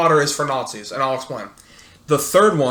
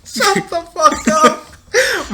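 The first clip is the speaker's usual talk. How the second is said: very high, slow laughing protest; squealed laughing protest; 3 dB louder, much slower than usual. Real, not acted. Friend rejects Moaning Myrtle, squealing with laughter.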